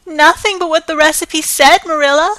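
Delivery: high, loud, pleading protest; anguished cry. Acted. Anne protests to Marilla in loud anguish. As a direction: cry it out in dismay. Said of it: "cried Anne with a look of anguish".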